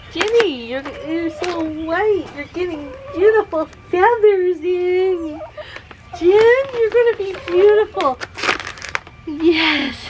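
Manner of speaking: very high, loving voice for the bird; high pet talk. Real, not acted. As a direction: coo over the bird with delight. Praising the bird.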